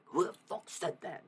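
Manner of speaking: loud, re-enacted combative challenge; war-zone outburst. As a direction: snap awake ready for a fight. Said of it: "I'm in fucking war zone".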